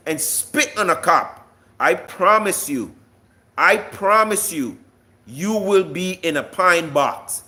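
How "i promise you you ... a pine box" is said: ordinary talk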